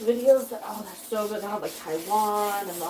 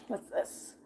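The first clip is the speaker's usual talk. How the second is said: whisper; 13 dB quieter than usual. Real, not acted. Reading chat, whispered aside.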